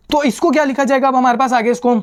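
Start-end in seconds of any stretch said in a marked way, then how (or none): none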